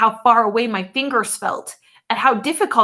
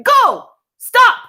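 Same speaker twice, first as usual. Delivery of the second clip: bellowed order; 13 semitones higher, 10 dB louder than usual. A narrator's reading. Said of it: "the man bellowed".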